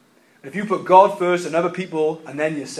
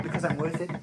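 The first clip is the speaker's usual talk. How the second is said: softly put-on bad American accent; mock an American shampoo ad, quiet and breathy-free; terrible American accent; 10 dB quieter than usual. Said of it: "a terrible American accent".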